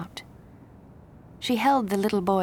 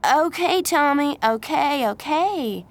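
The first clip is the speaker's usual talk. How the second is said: placating; 8 semitones higher, 6 dB louder, slower than usual. Acted. Calming a shouting child.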